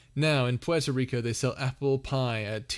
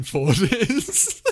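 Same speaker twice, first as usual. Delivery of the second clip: laughing; 8 semitones higher, 8 dB louder than usual. Real, not acted. Laughs at own joke.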